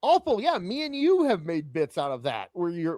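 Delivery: normal talking voice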